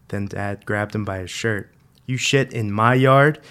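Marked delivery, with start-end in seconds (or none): 2.0-3.4 raised, loud question rising at the end; angry accusation. A narrator's reading. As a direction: challenge the kid with an incredulous question. Dad grabs kid, repeats accusation louder.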